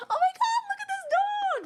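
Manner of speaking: very high delighted squeal; squealing over dog. Real, not acted. Seeing the ranch dog, a high gushing squeal.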